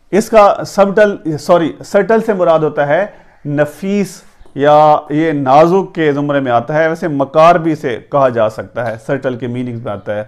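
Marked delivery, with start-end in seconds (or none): none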